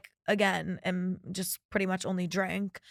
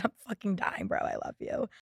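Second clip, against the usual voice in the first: whispered; 6 dB quieter than usual. Retold or imitated. Retelling her own reply text.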